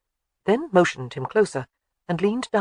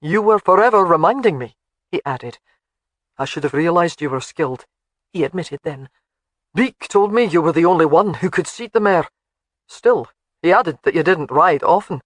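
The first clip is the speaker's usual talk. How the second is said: louder, gruff husband voice; gruff but fond; 5 dB louder than usual. A narrator's reading. Alec softening toward his wife.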